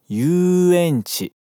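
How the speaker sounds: "Yuenchi" with a stretched, long "yu". Shown as a demonstration. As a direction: draw out the "yu" in "Yuenchi". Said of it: "atenção pra esticar o som do yu". ("Yuenchi" esticando o som do yu)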